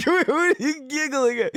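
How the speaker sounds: high-pitched, put-out questioning; indignant protest. Acted. Seeker to giggling guide, indignant protest.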